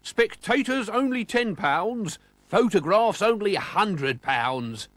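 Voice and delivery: high, loud, slow sales pitch with a grin; grinning. A narrator's reading. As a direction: hawk it smugly like a con man. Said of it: "grinned McClurk".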